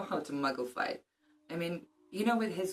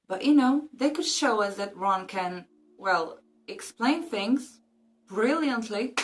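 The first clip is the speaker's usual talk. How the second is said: ironic; 6 semitones higher than usual. Real, not acted. Ironic praise of Ron.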